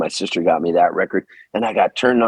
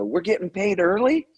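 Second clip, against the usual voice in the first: naive surprise; 10 semitones higher than usual. Retold or imitated. Naive reply to owner's call.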